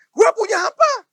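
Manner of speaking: loud, high cry of frustration; frustrated outburst. Retold or imitated. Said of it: "Frustasi ya?". (seruan frustrasi, keras dan tinggi)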